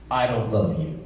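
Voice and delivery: normal talking voice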